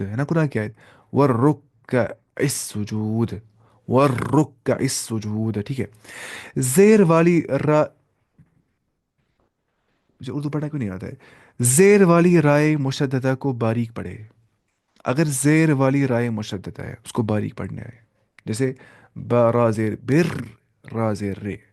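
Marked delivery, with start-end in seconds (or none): 1.1-5.6 वर रुक्का with a long heavy r, slow; heavy doubled r. Shown as a demonstration. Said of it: "मोटी आवाज़ से पढ़ना है". 19.2-20.3 बिर with a light thin r, slow. Shown as a demonstration. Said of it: "बारीक आवाज़ से पढ़ना है".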